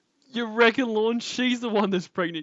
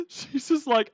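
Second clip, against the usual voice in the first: ordinary delivery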